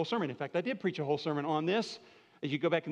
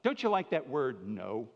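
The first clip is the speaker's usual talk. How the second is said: ordinary talk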